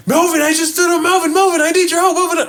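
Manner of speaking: imitating mom's panicked high calls; hysterical mom. Mom begging son for help, high frantic voice. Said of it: "She's hysterical".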